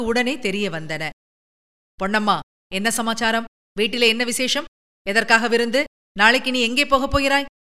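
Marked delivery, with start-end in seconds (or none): none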